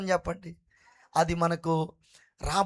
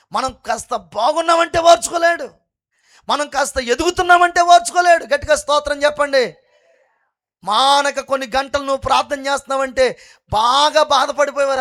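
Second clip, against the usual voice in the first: higher and louder with each line; fiery preaching; 4 semitones higher, 7 dB louder than usual. Acted. Preacher warning the church about the devil, building shout. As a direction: build the cry as each phrase climbs.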